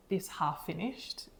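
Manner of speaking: normal talking voice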